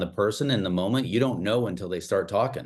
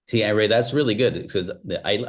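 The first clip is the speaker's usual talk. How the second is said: usual speaking voice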